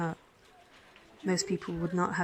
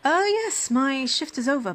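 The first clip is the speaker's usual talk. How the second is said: sudden high, louder oh; surprised exclamation; 6 semitones higher, 5 dB louder than usual. Acted. Nurse surprised the patient noticed her shift is over. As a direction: jump up in pitch, caught off guard.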